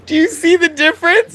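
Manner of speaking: loud, very high laughing squeal. Real, not acted. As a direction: squeal it out through real laughter. Laughing at the accent attempts.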